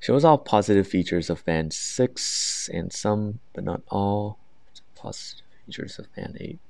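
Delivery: slow, careful rubric reading; very slow, deliberate reading of criteria; reading aloud. Shown as a demonstration. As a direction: read the descriptor out word by word. Reads band descriptor aloud.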